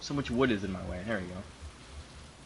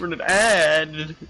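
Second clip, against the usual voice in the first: slow teasing read; 4 semitones higher, 7 dB louder, much slower than usual. Real, not acted. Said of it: "I've just now noticed that".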